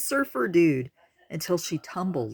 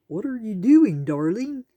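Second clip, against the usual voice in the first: confused; 6 semitones higher, 6 dB louder than usual. A narrator's reading. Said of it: "a confused Tor asked".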